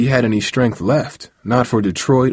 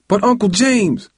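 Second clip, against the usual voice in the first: put-on very high, boyish objection; high, urgent protest; pleading protest; 12 semitones higher than usual. A narrator's reading. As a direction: plead with your uncle. Boy protests to his uncle again.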